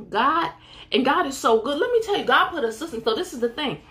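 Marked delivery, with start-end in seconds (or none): none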